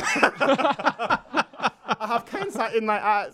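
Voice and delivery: slow, laughing repeat; laughing while repeating the guest; laughing echo. Retold or imitated. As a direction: chuckle through the echoed line. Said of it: "echoing guest".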